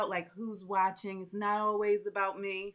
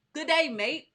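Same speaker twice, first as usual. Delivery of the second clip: "Good day, mate" tossed out higher and louder in a playful Australian twang; mock Aussie greeting; 6 semitones higher, 6 dB louder than usual. Host on a livestream greeting Australian viewers with a playful mock Aussie accent. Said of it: "so I could say, "Good day, mate".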